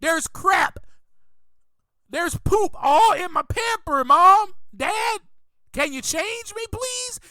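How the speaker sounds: pretend talking baby. Baby asks Mom and Dad for changing, mock plea. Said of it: "But baby can't tell you".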